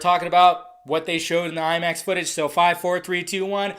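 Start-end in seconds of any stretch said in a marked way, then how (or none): none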